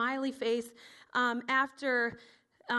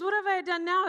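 higher, louder mock-exasperated retort; indignant protest; 7 semitones higher, 6 dB louder than usual. David answering his accusing older brother, indignant protest. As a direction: protest your innocence loudly.

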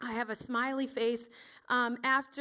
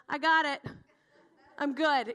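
louder, cocky announcement; breezy confidence; 2 semitones higher, 5 dB louder than usual. Acted. David flinging open Saul's tent flaps, breezily confident.

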